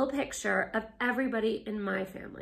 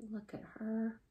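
whisper; 10 dB quieter than usual. Real, not acted. Aside to children about picture.

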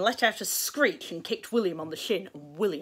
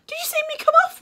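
high, loud eager question; bursting with pride in the crash; excited little boy; 10 dB louder, faster than usual. Acted.

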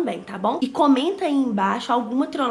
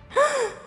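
gasp; 4 dB louder than usual. Real, not acted. Phone finally unlocks.

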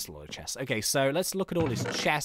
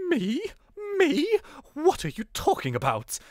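hammed-up posh suspect, high on "Me"; posh accent; 10 semitones higher than usual. Posh suspect denying the detective's charge, exaggerated posh accent. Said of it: "He should be really posh".

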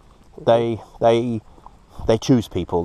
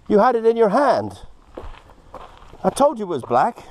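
giddy excitement; 12 semitones higher than usual. Real, not acted. Squealing with laughter at Sergey over the Moldavite. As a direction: squeal with real delight at the find. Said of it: "Sorry, a bit excited here".

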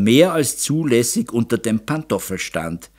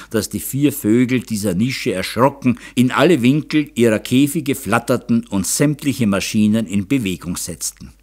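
plain reading voice

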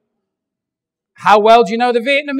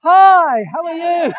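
higher, bright sing-song friendly greeting; cheerful mall guard; 7 semitones higher, 5 dB louder, slower than usual. Retold or imitated. Philippine mall guard with shotgun greeting shoppers, cheerful. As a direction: greet them sweetly despite the shotgun. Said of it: "and they're like".

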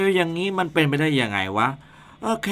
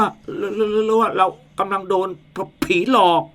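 higher, stuttering frightened voice; scared stammering; 6 semitones higher than usual. Acted. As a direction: stammer in rising panic. Man hears invisible ghost.

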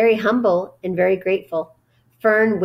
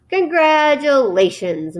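ordinary delivery, nothing marked

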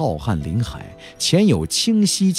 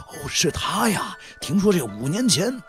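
soft, higher-pitched onlooker's voice; gossiping bystander; 7 semitones higher, 5 dB quieter than usual. Acted. Bystander cultivator to others about a famous visitor, hushed gossip. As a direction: recognise the man and pass on gossip quietly.

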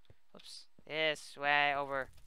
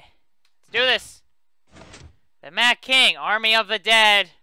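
hyped; 7 semitones higher, 16 dB louder than usual. Real, not acted. Hyping up for quest.

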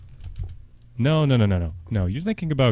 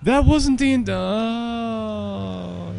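high, drawn-out moan; crushed gamer lament; wailing despair; 9 semitones higher, much slower than usual. Real, not acted. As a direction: whine it out, then trail into a wail. Despair at map not over.

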